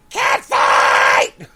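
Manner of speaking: slow shouted announcer call; wrestling commentator. Retold or imitated. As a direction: drag it out like a ringside announcer. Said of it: "who's that announcer guy?".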